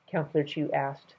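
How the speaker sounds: ordinary delivery, nothing marked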